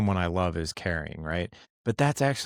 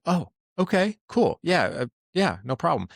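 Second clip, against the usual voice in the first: airy, much higher voice, easygoing okay; carefree family reply; 9 semitones higher than usual. Retold or imitated. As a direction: play the wife and kids waving it off lightly. Kids and wife shrug it off.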